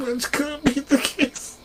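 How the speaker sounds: soft, high parroting through laughter; quiet squeaky imitation, drawn out; mocking mimicry. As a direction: parrot them in a tiny high voice. Mimics the other's squeaky protest.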